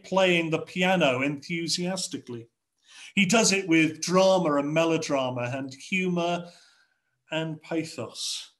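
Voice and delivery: usual speaking voice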